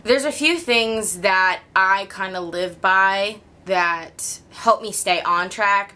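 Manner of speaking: ordinary talk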